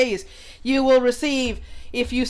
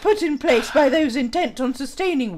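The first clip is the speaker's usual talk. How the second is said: put-on English accent, reading aloud. Streamer reading an article on patriarchy aloud, English accent. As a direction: read it out in a mock English accent. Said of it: "when I'm in my English accent".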